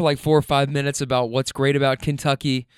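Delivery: ordinary talk, nothing marked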